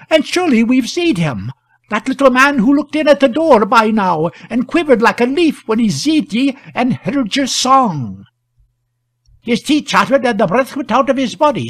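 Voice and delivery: high-pitched rustic voice; excited country shepherd. A narrator's reading. Villagers recount the frightened stranger, excited rustic voice.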